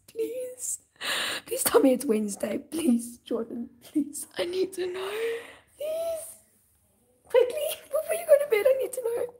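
high, pleading whine; whiny begging. Pretend. Streamer begging Jordan for the school date, high whiny plea. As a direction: beg like a desperate little kid.

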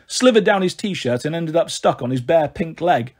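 plain reading voice

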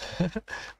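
short, high, soft laugh; self-amused chuckle. Real, not acted. Non-native speaker searching for an English word, self-amused chuckle. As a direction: laugh lightly at your own word choice.

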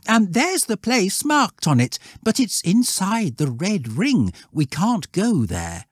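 put-on high boy's voice; prim lad explaining, pitched well up; cautious schoolboy. Voices Wilfred.

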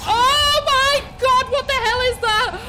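high, loud, slow shocked cry; shocked shout. Real, not acted. Player spotting a monster, high shocked shout. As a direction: gasp out in horrified disbelief.

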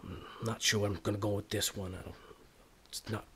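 low, halting self-talk; brooding to himself. Acted. Boxer alone, doubting the fight his uncle arranged, brooding. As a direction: think it out under your breath, unsure.

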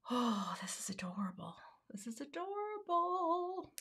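soft whispered delight; whispered coo. Real, not acted. Crafter admiring tiny scraps in a soft whisper. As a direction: breathe it out, charmed.

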